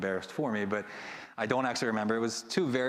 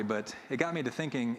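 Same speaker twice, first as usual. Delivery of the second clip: usual speaking voice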